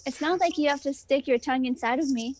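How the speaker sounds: ordinary talk, nothing marked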